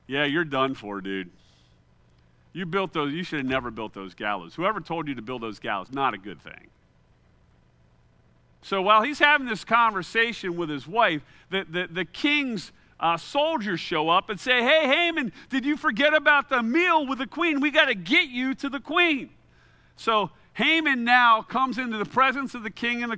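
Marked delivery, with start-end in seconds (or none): 0.1-6.7 deep, quiet doom-laden warning; low ominous wife voice. Retold or imitated. Wife voiced telling Haman off. 14.6-19.3 soldier calling out. Retold or imitated.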